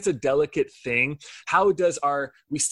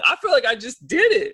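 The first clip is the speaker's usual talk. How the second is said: excited self-mocking punchline; 11 semitones higher, 6 dB louder than usual. Real, not acted. Joking self-aware punchline.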